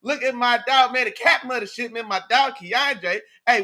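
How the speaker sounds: ordinary delivery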